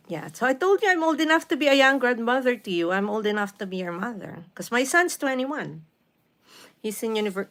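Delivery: ordinary talk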